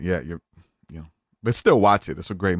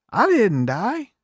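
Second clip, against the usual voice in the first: much higher, slow indignant denial; stubborn grandpa; 12 semitones higher, much slower than usual. Retold or imitated. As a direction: drag it out, offended. Grandpa denies dying.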